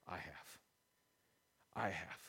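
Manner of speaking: very soft, low, with a pause; quiet testimony. Acted. Dad telling his child what he has seen, quietly. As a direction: say it low and hushed, letting it sit.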